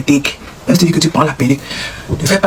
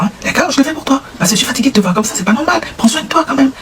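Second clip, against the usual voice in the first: put-on high pleading girlfriend; 8 semitones higher than usual.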